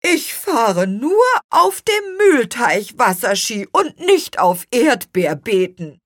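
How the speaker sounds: high, loud grumpy protest; grumbling. A narrator's reading. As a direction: grumble back indignantly. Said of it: "murrte Bohnenhals".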